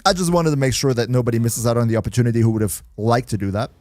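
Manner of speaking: ordinary talk, nothing marked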